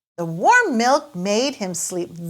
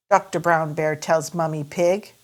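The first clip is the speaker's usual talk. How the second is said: usual speaking voice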